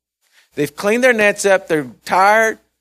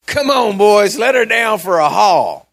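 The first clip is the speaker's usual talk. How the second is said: higher, louder rallying shout to the crew; rousing call; 4 semitones higher, 4 dB louder than usual. Retold or imitated. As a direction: call the boys out with gusto. Preacher voices Jesus rallying the fishermen.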